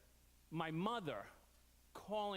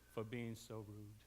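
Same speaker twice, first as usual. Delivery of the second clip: meek apology; 9 semitones lower, 7 dB quieter than usual. Retold or imitated. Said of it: "he said".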